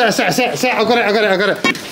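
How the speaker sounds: ordinary talk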